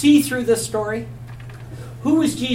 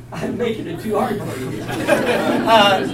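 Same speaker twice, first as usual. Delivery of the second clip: amused teacher laughing gently; slower than usual. Real, not acted. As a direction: chuckle at the class's guess. Laughs at class's wrong answer.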